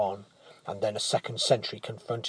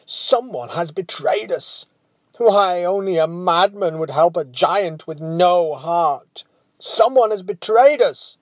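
higher, louder angry outburst; a furious king who feels betrayed; angry father; 6 semitones higher, 8 dB louder than usual. Retold or imitated. King raging to his men about the traitor, angry. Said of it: "His father's angry words".

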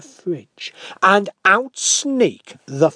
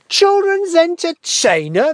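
much higher, louder scornful shout; 13 semitones higher, 8 dB louder than usual. Acted. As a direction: scoff loudly together. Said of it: "they all shout".

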